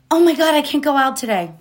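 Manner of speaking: high, loud, quick mock panic; hammed-up self-pitying wail; mock dismay. Acted panicked self-talk over a zit.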